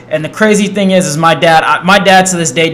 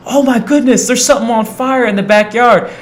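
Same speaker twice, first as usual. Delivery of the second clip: higher, alarmed sister voice; 7 semitones higher than usual. Retold or imitated. Sister noticing fire in the backyard, alarmed. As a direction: sound the alarm like his sister. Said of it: "my sister's like".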